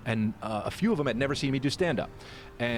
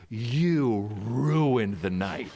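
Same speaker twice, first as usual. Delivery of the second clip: drawn-out, put-on reproach; imitating an outraged friend, very slow; mock accusation; much slower than usual. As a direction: stretch every word out accusingly. Friend's accusation acted as punchline.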